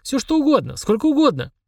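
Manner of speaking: high delighted squeal; giddy with greed; overjoyed. A narrator's reading. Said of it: "обрадовался Денис".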